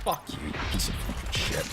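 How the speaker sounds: whispered cursing. Real, not acted. Muttered curse at the game.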